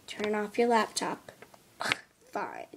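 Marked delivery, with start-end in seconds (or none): 1.8-2.7 grudging. Acted. Grudging surrender.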